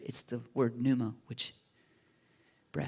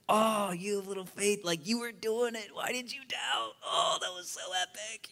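high-pitched, thrilled big-brother voice; hyped-up, high brotherly encouragement; excited brother; 7 semitones higher than usual. Acted. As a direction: cheer him on like an excited brother. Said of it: "in the excited brother's voice".

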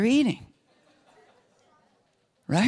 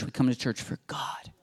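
soft intimate whisper; whispered emphasis; 2 semitones lower, 5 dB quieter than usual. Acted. Preacher to congregation, sudden whisper. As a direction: drop to a whisper for the point. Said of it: "we don't come to church for church".

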